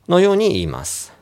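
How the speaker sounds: normal talking voice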